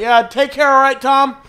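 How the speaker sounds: loud, high, chummy send-off; friendly small-town cop. Retold or imitated. Cop leaving uncle Barry, chummy mock send-off. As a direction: sign off like an old pal. Cop's friendly goodbye, acted out.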